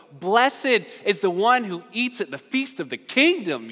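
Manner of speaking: a newcomer proudly toasting the feast; giddy toast. Retold or imitated. Guest at Jesus' dinner toasts the kingdom, giddy. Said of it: "He's very giddy right now".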